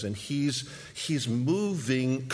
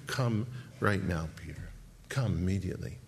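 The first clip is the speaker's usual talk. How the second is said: gentle call; 6 semitones lower, 7 dB quieter than usual. Acted. Jesus calling Peter.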